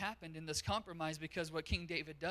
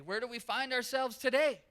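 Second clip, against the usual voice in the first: higher, louder pressing question; preacher pressing the congregation to answer; louder emphatic repeat; 6 semitones higher, 7 dB louder than usual. Real, not acted. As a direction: push the question home.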